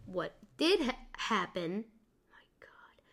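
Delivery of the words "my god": dismayed whisper. Real, not acted. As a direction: whisper it in disbelief. Dismayed aside.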